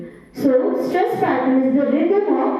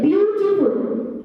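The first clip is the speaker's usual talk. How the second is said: beautiful stretched out slowly on a held, higher first syllable; stress on beautiful; 5 semitones higher than usual. Shown as a demonstration. Teacher showing word stress to parents, beautiful stressed and drawn out. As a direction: lean long on the start of beautiful. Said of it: "So in that we are putting stress".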